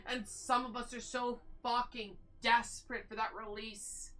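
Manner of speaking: ordinary delivery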